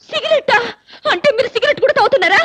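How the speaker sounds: high, scandalised questioning; scandalised wife's outcry; shocked accusation. Acted. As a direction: gasp it out high in disbelief. Wife shocked that husband smokes.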